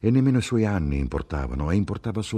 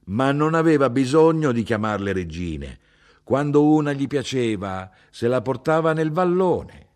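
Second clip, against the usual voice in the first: higher, louder mother, heated and scornful; a heated old Sicilian mother correcting her son; mother's voice, indignant; 6 semitones higher, 4 dB louder than usual. Acted. Mother to son about grandfather, higher and louder indignant voice.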